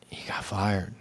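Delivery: near-whispered revelation; hushed bad news. Colleague telling teacher the director got fired, whispered. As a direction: break it in a whisper.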